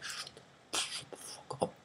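barely voiced, very quiet fury; seething inner voice. Quiet classmates thinking at the noisy class, seething whisper. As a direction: whisper it under your breath. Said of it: "inside they're feeling like".

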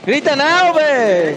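yelling. Real, not acted. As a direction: yell it across the squad. Yelling at teammate mid-fight. (le grita al compañero en plena pelea)

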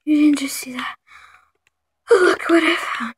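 shocked whisper; whispering in amazement; awed whisper. Real, not acted. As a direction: hiss it in disbelief.

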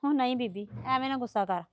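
low, soft placating; quiet reassurance; soothing. Acted. Calming the angry mother-in-law.

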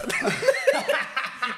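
2 high loud burst of laughter; 3 cracking up; 4 a real laugh, high and loud. Real, not acted. Laugh alone on line.

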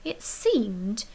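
plain reading voice

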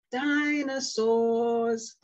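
DINOSAURS very slow and loud; drawn-out teaching word. Shown as a demonstration. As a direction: stretch DI-NO-SAURS out for a child. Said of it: "Say dinosaurs".

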